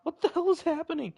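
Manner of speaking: very high, loud squawk; loud, high-pitched alarm; shocked bewilderment. Real, not acted. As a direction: yell it in disbelief. Startled by game scene.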